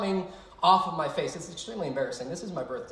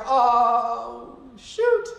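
long high "oh", then a squeaked shoot; comic mock alarm, high and loud; retold dread; 7 semitones higher, 7 dB louder than usual. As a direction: act out a loud, drawn-out realization of being too high. Retelling dread on looking down.

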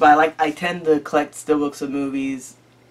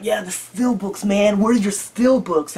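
slow drawn-out plea; 5 semitones higher, slower than usual. Retold or imitated. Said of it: "I was like".

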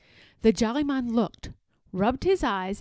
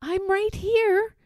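high, slow gentle dolphin; a kind sea creature answering; magical dolphin voice; 9 semitones higher, slower than usual. Acted. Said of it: "a familiar voice replied".

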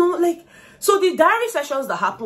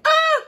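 very high-pitched "ah" of delight; sudden high fangirl squeal; delighted gasping squeal; 5 dB louder than usual. Retold or imitated. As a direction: squeal as if melting at something sweet. Reenacts reaction to a sweet moment.

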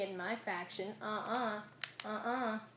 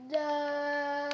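long, raised, loud wail of no; dismayed cry; 5 semitones higher, 6 dB louder than usual. Real, not acted. Gamer whose shovel is almost dead, dismayed cry. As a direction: draw out the no, high and loud.

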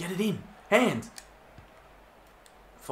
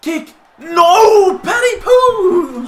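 desperate shout; 12 semitones higher, 11 dB louder, much slower than usual. Real, not acted. Urging a kick, then groaning.

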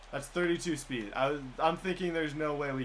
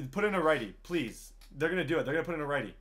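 ordinary delivery

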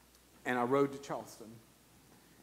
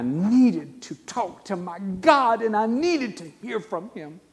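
slow, rising from loud to a high, louder plea; building preaching cry; 10 semitones higher, 13 dB louder, slower than usual. Acted. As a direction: pour out the longing to God, slowly, building to full voice. Preaching climax of longing.

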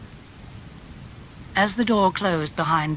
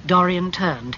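ordinary delivery, nothing marked

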